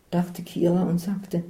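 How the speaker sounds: usual speaking voice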